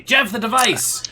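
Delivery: loud, high-pitched yell; impatient shouted order. Acted. Leader demands device from underling Jeff, impatient shout.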